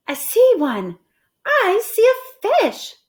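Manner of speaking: high, loud, eager; thrilled to spot a fish; excited Amelia Bedelia. Acted. Amelia Bedelia spotting a fish, high and excited. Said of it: "she said".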